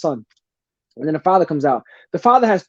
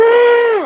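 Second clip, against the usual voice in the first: long, high open-throated yell; imitated roar at tiger; 4 dB louder than usual. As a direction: act out yelling at a tiger, holding the note. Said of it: "I went like that".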